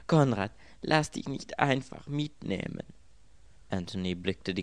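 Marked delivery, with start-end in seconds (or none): 0.1-2.9 high-pitched, plaintive wail. A narrator's reading. Girl begs Conrad not to go along, wailing. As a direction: plead in a raised, lamenting voice. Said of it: "jammerte das Mädchen".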